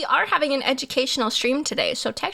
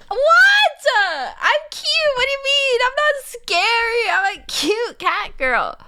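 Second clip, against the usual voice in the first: put-on cutesy cat girl; 13 semitones higher, 4 dB louder than usual. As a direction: play the wounded cute catgirl. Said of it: "defends being cute".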